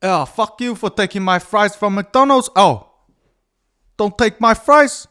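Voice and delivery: a mock-furious son in the right accent, half sung; retelling the son's McDonald's fries fight in a sing-song yelling accent; corrected accent. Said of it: "no, wrong accent".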